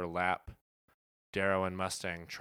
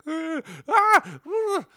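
much higher, louder mock wailing; mock pained cries; 10 dB louder, slower than usual. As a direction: squeal out a hammy pained ah. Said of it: "he's like".

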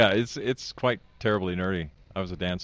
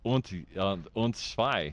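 lightly attempted German accent; counting in careful German; slower than usual. Shown as a demonstration. Podcaster trying German on request, light German accent. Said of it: "Can you do the German accent".

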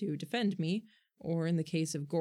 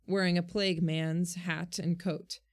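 ordinary delivery

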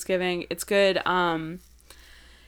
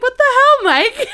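high, loud mock outrage at the husband; exasperated mom; 11 dB louder than usual. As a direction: yell at Mike like a fed-up wife. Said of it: "She's like".

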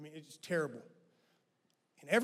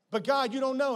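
loud, higher protest to God; desperate plea; 9 semitones higher, 10 dB louder than usual. Retold or imitated. The preacher protesting to God, loud and high. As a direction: push back on God hard.

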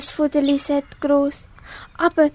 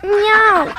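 high plaintive meow; a child's high kitten mew; 4 semitones higher, 6 dB louder than usual. Acted. Kitten meows.

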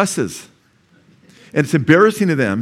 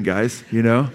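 ordinary talk, nothing marked

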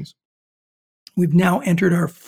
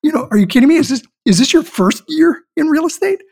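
higher, louder incredulous questions; can't believe what he's hearing; retold shock, pitched up high and loud; shocked disbelief; 10 semitones higher, 5 dB louder, faster than usual. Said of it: "And I was shocked".

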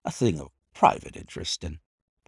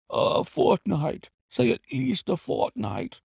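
slow, high begging; despairing plea; 12 semitones higher than usual. A narrator's reading. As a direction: bargain desperately for more time. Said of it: "he cried in a despairing voice".

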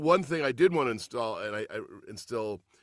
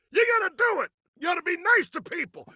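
much higher, loud hammed-up yell; mock yelling; 8 dB louder than usual. Father mocking himself yelling kindness at his son, hammed-up shout. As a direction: bark it like a fed-up parent. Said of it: "While you're yelling at him".

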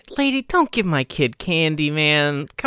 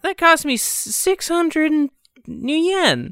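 put-on crying orc kid; 9 semitones higher, slower than usual. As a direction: whine like an upset child over his toy. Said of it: "points over to an orc kid who's, like, crying".

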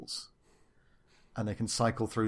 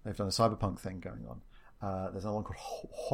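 normal talking voice

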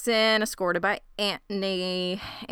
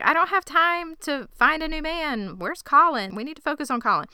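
exasperated higher voice; 8 semitones higher than usual. Acted. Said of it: "She's like".

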